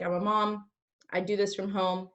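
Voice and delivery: ordinary talk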